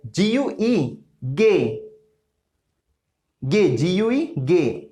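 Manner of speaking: slow जीयूई said as गे, hard g; gue said as ge. Shown as a demonstration. Teacher spells gue for students, slow and clear. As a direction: spell जीयूई then say गे slowly. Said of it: "और जीयूआई".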